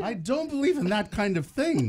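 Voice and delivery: high, loud mock-senator voice; Orrin Hatch. Guest disowning the trick as a senator, high indignant impression. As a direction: protest like a scandalised pious politician. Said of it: "try Orrin Hatch".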